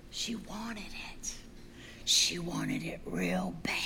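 slow, intense storyteller's whisper; hushed whisper. A narrator's reading. Preacher telling how Eve craved the fruit, slow whisper. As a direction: drop to a whisper and stretch it out. Hushed dramatic retelling of temptation.

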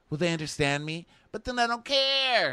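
high-pitched mock angst; hammed-up brooding baby, climbing to a wail; whiny big baby. Dean agonizing over being understood, whiny big baby. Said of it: "Fucking baby".

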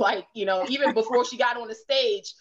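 usual speaking voice

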